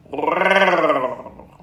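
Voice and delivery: big loud tiger growl. A narrator's reading. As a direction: pounce with a roaring growl. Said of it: "And with one loud".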